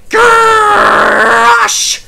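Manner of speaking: high loud startled shout; startled cry. A narrator's reading. Mavis caught out by Toby, a loud high yelp. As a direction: yelp it out in shock. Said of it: "said Mavis".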